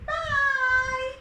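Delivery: high, loud fish goodbye; a magic fish calling farewell; bright high farewell from the fish; fish says bye. Acted. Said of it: "the fish also said".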